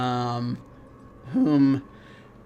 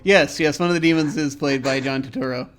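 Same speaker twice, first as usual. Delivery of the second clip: ordinary delivery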